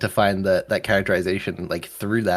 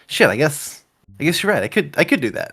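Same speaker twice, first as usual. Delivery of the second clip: retold dawning realisation; 8 semitones higher, 4 dB louder than usual. Retelling own dawning realisation.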